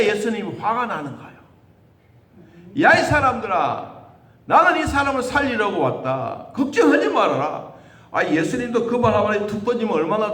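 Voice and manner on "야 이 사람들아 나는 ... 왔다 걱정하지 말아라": Jesus calling out. Pretend. Jesus voiced addressing mourners.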